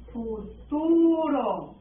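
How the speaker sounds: futuro very slowly, with a long TU; drawn-out dictation. Shown as a demonstration. Teacher writing the tense for the class, futuro slowly drawn out. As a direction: stretch out futuro syllable by syllable.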